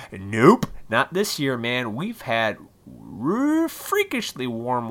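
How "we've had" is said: ordinary talk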